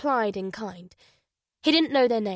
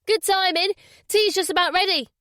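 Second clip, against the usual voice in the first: woman's voice; 10 semitones higher, 4 dB louder than usual. Acted. Woman voiced, calling down stairs.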